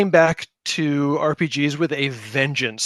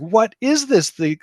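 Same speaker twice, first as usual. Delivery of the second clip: amazed wonder; 7 semitones higher, 3 dB louder than usual. Retold or imitated. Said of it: "I was just like".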